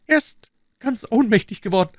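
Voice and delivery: high, anxious servile voice; bow and scrape with every word; nervous, eager innkeeper; fawning innkeeper. A narrator's reading.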